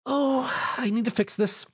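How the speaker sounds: high wheezy hurry; wheezing. A narrator's reading. Pinkie, masked and ill, wheezes hurrying home. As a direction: wheeze through the mask. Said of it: "she wheezed".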